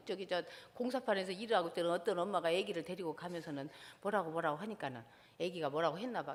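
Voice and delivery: ordinary talk, nothing marked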